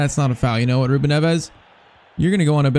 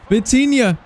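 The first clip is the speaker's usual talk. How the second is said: high pitched cries at a near miss; will it in, then lose it; excited groan of hope; 8 semitones higher than usual. Real, not acted. Player crying out as Vitinha misses the chance.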